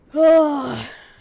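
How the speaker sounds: big loud exasperated sigh. Real, not acted. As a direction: let out a loud groaning sigh. Only one crop grew.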